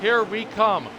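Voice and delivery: rising excited race call; motorsport commentator. Acted. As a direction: call the final lap, building hype.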